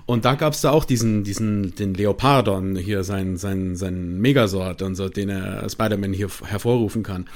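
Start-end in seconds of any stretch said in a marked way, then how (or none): none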